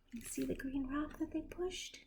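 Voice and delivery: whispered aside to the listener; soft whispered question about the picture. Real, not acted. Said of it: "aside to listening child".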